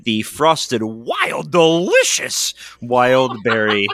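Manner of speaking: slow, exaggerated announcer-style drawl; vintage cartoon voice. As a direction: stretch it out like an old cartoon. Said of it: "You sound like a cartoon character".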